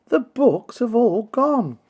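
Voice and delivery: put-on high, loud and slow, a boy in distress; dismayed child Peter. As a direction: wail it out like a heartbroken boy. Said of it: "cried Peter".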